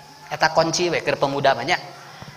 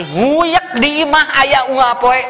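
higher, slow and savoured; delighted; 6 semitones higher, 4 dB louder, slower than usual. Acted. Preacher picturing children at home, slow delight. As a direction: stretch it out with joy.

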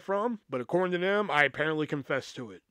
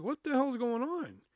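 high-pitched baffled question, slightly softened; bewildered; 9 semitones higher, 5 dB quieter than usual. Retold or imitated. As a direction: ask it pitched up in retold disbelief. Bewildered reaction to the accusation.

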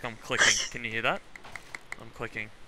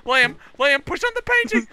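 higher, frantic shout; high urgent shout; 11 semitones higher, 7 dB louder than usual. Real, not acted. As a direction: shriek it now, desperate. Repeated, more urgent.

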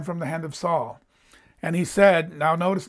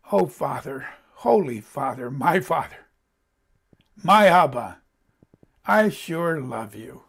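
tender prayer; 4 semitones lower than usual. Shown as a demonstration. Preacher showing how to tell the Father "love you", low and tender. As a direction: pray it gently, leaving room between phrases.

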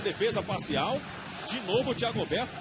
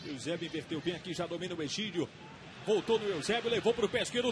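ordinary delivery

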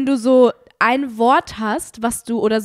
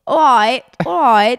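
louder, drawn-out British "Alright, alright."; lightly British, louder; mock British alright; 6 dB louder, slower than usual. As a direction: say "Alright, alright." like a posh Brit, louder. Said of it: "so britisch wie so".

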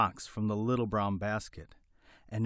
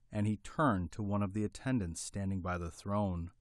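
ordinary delivery

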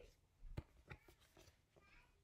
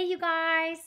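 usual speaking voice

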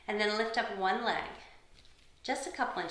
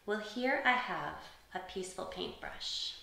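ordinary talk, nothing marked

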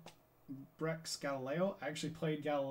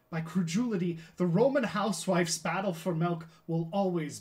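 loud, raised theatrical oratory; Galileo, indignant; 4 semitones higher, 11 dB louder than usual. Acted. Galileo on the people's tormentors, impassioned and declamatory. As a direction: declaim it to the back row, over the top.

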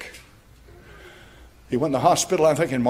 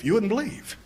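usual speaking voice

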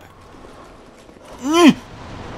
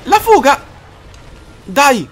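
high, loud panicked yelling; frantic shout; 12 semitones higher, 7 dB louder, slower than usual. Real, not acted. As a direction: yell while fleeing. Swarmed during fight. (grido in fuga)

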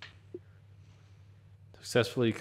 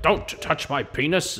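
mock arrestee; 7 semitones higher, 5 dB louder than usual. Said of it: "he's like".

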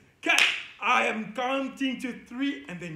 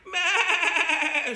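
loud, high bleat stretched through "my"; bleating sheep; 6 semitones higher, 6 dB louder than usual. Pretend. Teacher clowning the verse for kids, bleating like a sheep. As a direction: turn "my" into a long "maaa" bleat.

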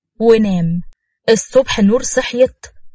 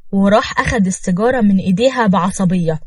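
usual speaking voice

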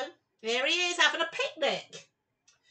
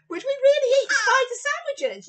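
mock disbelief; 8 semitones higher, 9 dB louder, faster than usual. Mock disgust at spider sandwiches.

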